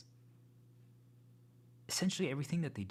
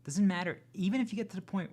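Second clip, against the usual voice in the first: higher and louder, insisting hard; emphatic insistence; 8 semitones higher, 5 dB louder than usual. Real, not acted. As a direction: push it out, almost shouting.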